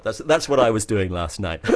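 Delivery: laughing. Real, not acted. Admits his guilty secret, laughing.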